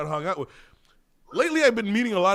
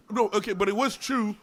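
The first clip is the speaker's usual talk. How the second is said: ordinary delivery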